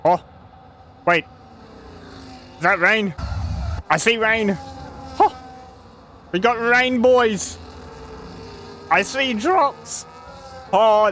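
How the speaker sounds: high, thrilled shout about rain; suddenly delighted, voice jumping up; excited. Real, not acted. Spots rain mid-race, thrilled.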